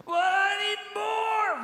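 high, loud, slow drawn-out whine; whiny kid. Retold or imitated. Kid on road trip begging mom, drawn-out whine. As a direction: stretch the complaint like a pouting child. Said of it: "a kid in the back seat".

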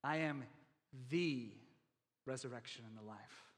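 first "the" set alone between pauses and held; pause, stretch the first "the", then finish low and soft; stress on THE. Shown as a demonstration. Preacher asking "how about this one", stress on the first "the". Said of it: "How about this one?".

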